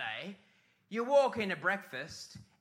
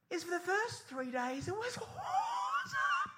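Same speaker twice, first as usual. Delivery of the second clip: high, slow mock rapture; squealing mock joy over the cruise; gushing delight; 3 dB quieter, slower than usual. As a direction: gush like a thrilled tourist.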